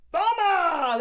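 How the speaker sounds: high loud thumbs up; loud verdict. Real, not acted. Vlogger shouting a rating to viewers, high and loud. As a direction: belt it out like a game-show host.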